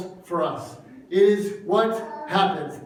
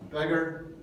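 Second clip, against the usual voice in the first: low, hushed put-down; soft, low retold rejection; quiet dismissal; 6 semitones lower, 12 dB quieter than usual. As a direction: drop right down to dismiss the beggar. Crowd's dismissal voiced, dropping low.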